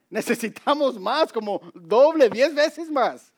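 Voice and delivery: high, rising mock-panicked plea; mock alarmed protest. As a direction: protest in a high voice, stretching the last words. Voicing Gideon pleading with God.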